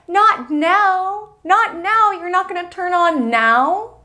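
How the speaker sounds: high pleading frustrated whine. Pretend. Driver whining high at the dead car. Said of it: "And you're so frustrated".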